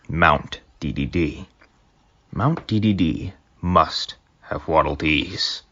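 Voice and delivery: ordinary delivery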